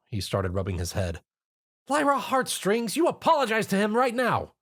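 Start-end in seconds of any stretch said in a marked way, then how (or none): 1.9-4.5 high, stern scolding order; commanding. A narrator's reading. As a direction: order her to say sorry. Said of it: "Twilight commanded".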